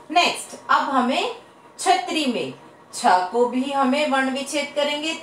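ordinary delivery

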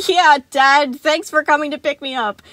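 grinning, loud and high; through a grin. A narrator's reading. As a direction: fake it with a big grin. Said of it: "said through a grin".

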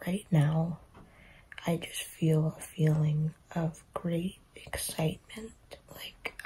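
whispering quietly at night; soft unvoiced late-night whisper. Real, not acted.

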